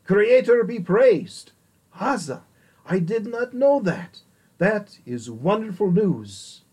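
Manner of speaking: loud, high exclamation of joy; joyous amazement. A narrator's reading. Pylos's ambassador hears his friend's news, exclaiming in joyous amazement. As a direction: exclaim it in delighted wonder, voice lifted high. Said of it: "beamed in joyous amazement, and exclaimed".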